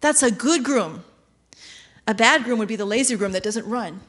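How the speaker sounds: ordinary delivery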